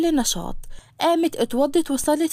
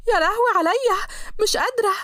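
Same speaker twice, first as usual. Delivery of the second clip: put-on a girl wailing over a scraped leg; pained wail; 5 semitones higher than usual. Hour falls and hurts her leg.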